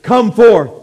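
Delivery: high, loud commanding shout; ringing, full-force summons; commanding call. Retold or imitated. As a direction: fire it out as an irresistible order. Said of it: "God said through the Spirit".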